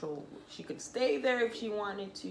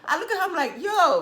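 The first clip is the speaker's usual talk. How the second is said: laughing excitement; 11 semitones higher, 13 dB louder than usual. Real, not acted. Marveling at the baby.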